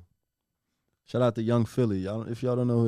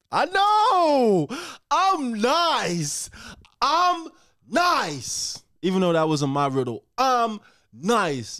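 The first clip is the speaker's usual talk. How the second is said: boastful; 11 semitones higher, 3 dB louder, much slower than usual. Real, not acted. Bragging after solving riddle.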